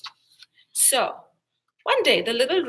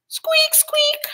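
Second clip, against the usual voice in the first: very high squeaky rat; 6 dB louder than usual. A narrator's reading. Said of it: "said the rat".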